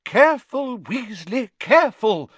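high, loud warning call; crying out. A narrator's reading. Professor Sprout warning her pupil, crying out. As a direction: call out in sudden alarm. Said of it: "cried Professor Sprout".

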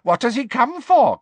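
high, loud elf voice; wondering house elf. A narrator's reading. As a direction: wonder aloud as a sly elf. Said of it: "Creature wonders".

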